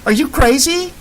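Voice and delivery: high, incredulous cry; shocked disbelief. Acted. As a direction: blurt it out in disbelief. Character shocked outburst.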